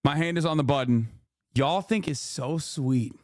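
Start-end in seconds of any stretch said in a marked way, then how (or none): 1.5-3.1 slow, drawn-out taunt; slow mock-sweet needling. Real, not acted. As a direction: stretch it out scornfully at them. Taunting the chat with slow repeats.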